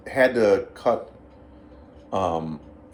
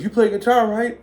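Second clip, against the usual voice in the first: high, loud acted question; imitating a bandleader demanding confirmation; insistent check; 12 semitones higher, 6 dB louder than usual. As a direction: press for a yes, pitch jumping up. Bandleader anxiously checks with new player.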